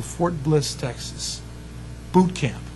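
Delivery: ordinary talk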